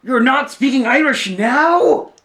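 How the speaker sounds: loud, high, slowly stretched question; mock incredulity. Host acting out a baffled reaction to the accent, mock-incredulous. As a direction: draw it out, rising loudly on the last word. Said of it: "He's like".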